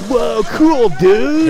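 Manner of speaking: high, loud mock dude-speak; imitating unfazed onlookers. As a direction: mimic a thrilled, clueless bro. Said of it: "doesn't sound like any of those guys are freaking out".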